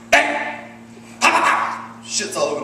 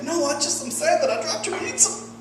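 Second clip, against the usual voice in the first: much higher, soft, mock-weepy stammer; a hammed-up tearful wife squeak; mock crying wife; 10 semitones higher, 4 dB quieter than usual. Comic imitates his wife sobbing over dropped pizza, high and weepy. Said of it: "She started crying".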